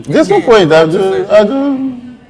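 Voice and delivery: high, loud frustrated outburst; exasperated. Real, not acted. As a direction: throw up hands, voice rising.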